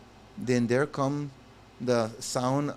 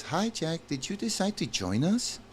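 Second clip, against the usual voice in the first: put-on lightly higher sweet girl voice; 6 semitones higher than usual. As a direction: voice her a bit higher. Voicing Anna.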